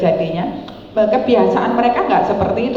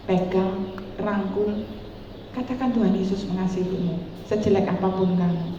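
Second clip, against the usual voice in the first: gentle comfort; 8 dB quieter than usual. Retold or imitated. Comforting the HIV-positive woman.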